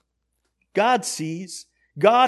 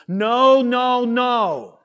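higher, louder refusal with drawn-out noes; emphatic mama; 5 semitones higher, 6 dB louder, much slower than usual. Acted. Mama telling you you can't have cookies, emphatic refusal. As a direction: refuse like an insistent mother. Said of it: "like your mama telling you you can't have cookies".

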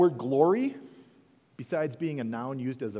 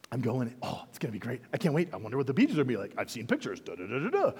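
excited chatter; 4 semitones lower, much faster than usual. Acted. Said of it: "You would be so excited".

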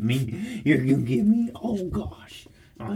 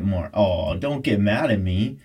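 ordinary talk

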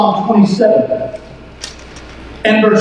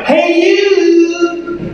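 high, sustained call at usual volume; 6 semitones higher than usual. Shown as a demonstration. Preacher showing congregation how to hail a neighbor, high call. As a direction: stretch it out like hailing a neighbor. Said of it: "look at your neighbor and go".

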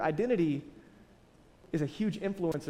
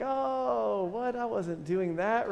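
put-on caught kid denying; 4 semitones higher, much slower than usual. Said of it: "You're like".

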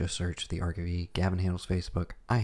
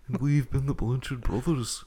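hammed-up host voice; 5 semitones higher than usual. Hammed podcast sign-off.

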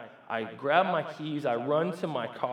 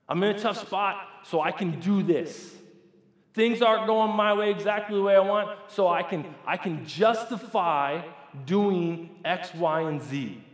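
raised, insistent excuse-making; mock rationalizing; self-justifying; 5 semitones higher, 6 dB louder than usual. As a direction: argue your own excuse emphatically.

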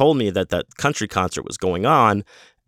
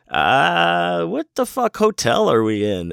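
high-pitched, lost and baffled; lightly voiced baked buddy, bewildered; confused stoned friend; 9 semitones higher, slower than usual. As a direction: sound like a high guy with no clue where he is. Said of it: "And he's like".

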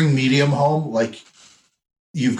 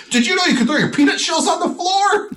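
very high, over-the-top gushing; hammed-up sarcastic enthusiasm; mock excitement; 3 dB louder than usual. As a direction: gush in mock amazement, pitched way up. Mock enthusiasm relaying the restaurant's wonders.

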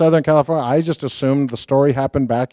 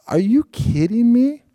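pitched way up, giddy and unbelieving; thrilled disbelief; 8 semitones higher than usual. Retold or imitated. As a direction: gasp it out like you can't believe your luck. Thrilled on hearing the introduction.

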